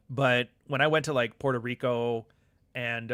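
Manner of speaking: normal talking voice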